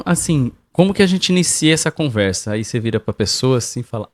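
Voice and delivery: usual speaking voice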